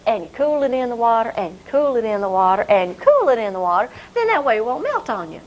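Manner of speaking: usual speaking voice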